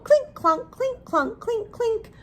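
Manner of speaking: sing-song plinking sounds, rising high; ukulele clink clunk. Acted.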